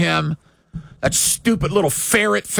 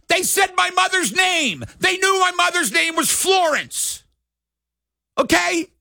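heated rant; 7 semitones higher than usual. Real, not acted. Ex-ballplayer yelling about fans insulting his mother. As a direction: shout it, worked up and indignant. Ranting about hecklers and sissies.

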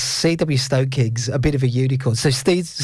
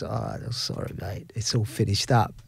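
soft offhand barman; 4 semitones lower, 7 dB quieter than usual. Retold or imitated. Retold barman brushing him off.

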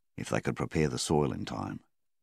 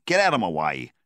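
louder, forceful command; sharp order; 2 semitones higher, 6 dB louder than usual. A narrator's reading. Donnie pushing Flynn aside, loud forceful order. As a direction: clear him out of the way.

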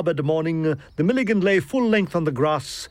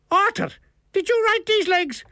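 very high Irish character voice; incredulous Milligan. A narrator's reading. Milligan accuses the author over his legs, incredulous and high. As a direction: question it with pitched-up indignation.

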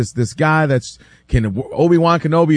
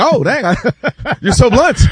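higher, laughing retold reaction; amused mock surprise; 6 semitones higher than usual. Interviewer reacting to a player's bold boast, amused mock surprise. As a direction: imitate being taken aback, pitched up.

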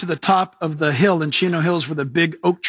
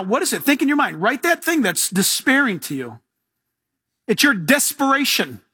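ordinary talk, nothing marked

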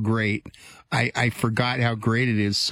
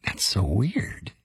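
whisper; 3 semitones lower, much slower than usual. Real, not acted. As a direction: hush it like a secret. Spooky aside after revelation.

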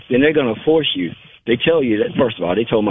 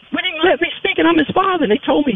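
upset high outburst. Real, not acted. Father angry about son's trial.

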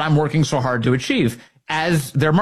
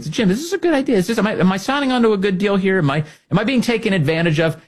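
mock asking Jim; 7 semitones higher, faster than usual. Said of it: "I ask Jim, like".

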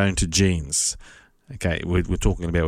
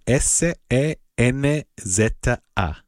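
spelled out; 3 semitones higher, slower than usual. Shown as a demonstration. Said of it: "spelling out the word".

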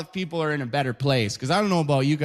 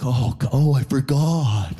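slow stoned realization; slower than usual. Retold or imitated.

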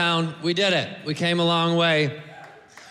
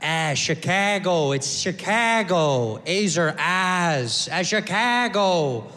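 slow, stretched-out Chicago vowels; thick Chicago accent; 4 semitones lower, much slower than usual. Retold or imitated. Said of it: "with that thick Chicago accent".

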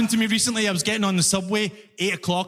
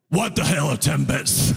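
low, baffled question; baffled reply; 7 semitones lower than usual. Retold or imitated. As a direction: act totally lost. Confused immigrant answering customer.

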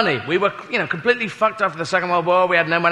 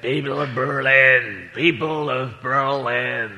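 slow, low attempt at an orator; badly faked presidential address, drawn out; President Kennedy; 7 semitones lower, 5 dB quieter, slower than usual. President addresses the Berlin crowd, slow badly faked impression. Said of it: "President Kennedy made that fantastic speech".

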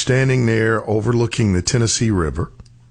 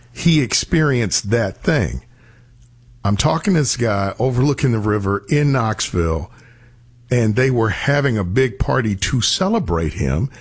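usual speaking voice

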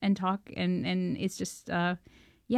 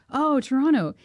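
higher, slow and a bit louder, fondly curious; a celebrity delighted to hear where a fan comes from; curious friendly question; 7 semitones higher, 5 dB louder, slower than usual. Retold or imitated. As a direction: ask it like a kindly older man reminiscing. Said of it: "He's like".

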